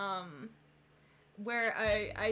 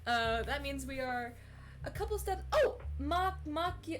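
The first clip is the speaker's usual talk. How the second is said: ordinary talk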